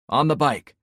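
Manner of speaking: loud, urgent order; commanding shout. Acted. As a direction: bark the order over the noise. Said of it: "I ordered".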